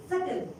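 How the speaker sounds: SUBTLE with no B sound; "subtle" said as "suttle"; silent B. Shown as a demonstration. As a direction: leave the B out of "subtle". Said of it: "B sound will never come".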